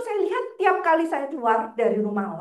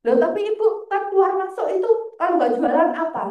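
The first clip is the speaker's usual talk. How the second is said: higher-pitched, puzzled imitation of a guard; suspicious challenge; 5 semitones higher, 4 dB louder than usual. Guard questioning a visitor seen going in and out, mock suspicious. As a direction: challenge them, pitched up in disbelief.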